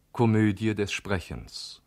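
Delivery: ordinary delivery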